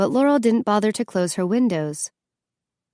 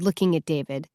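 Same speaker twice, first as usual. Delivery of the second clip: ordinary delivery, nothing marked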